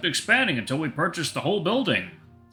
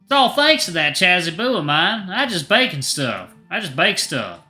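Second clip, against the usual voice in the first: playful Southern husband, bashful about the praise; Southern accent; 6 semitones higher, 4 dB louder than usual. Acted. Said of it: "His cadence and southern accent".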